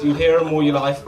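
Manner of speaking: usual speaking voice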